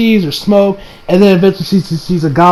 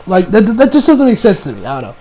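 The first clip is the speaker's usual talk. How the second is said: ordinary talk, nothing marked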